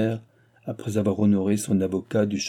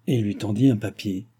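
plain reading voice

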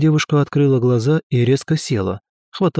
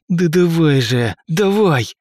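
strained, higher push through clenched teeth; through gritted teeth; 6 semitones higher, slower than usual. Acted. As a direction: force it out with all your strength. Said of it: "цедил я сквозь зубы". (сквозь стиснутые зубы)

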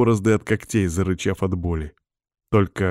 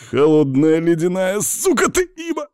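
high, louder outburst climbing in rage; pained angry cursing; 10 semitones higher, 4 dB louder than usual. Acted. Wounded man insults the witch treating him, rising furious cry. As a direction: curse her as the pain peaks.

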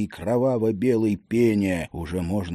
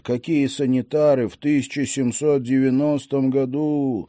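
ordinary delivery

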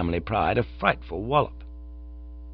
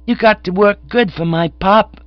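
put-on high, loud bossy kid; reproving child; 12 semitones higher, 8 dB louder than usual. A narrator's reading. Stout child telling off Cyril, high and reproving. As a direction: scold as a small child. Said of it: "waggling his head reprovingly at Cyril".

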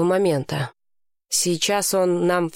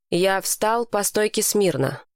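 plain reading voice

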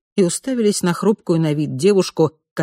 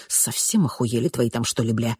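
crackly walkie-talkie, muffled; like it comes through a hissing radio; 5 semitones lower, 5 dB quieter than usual. Acted. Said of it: "Рация зашипела, издала плохо разборчивое".

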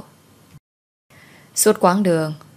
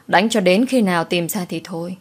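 usual speaking voice

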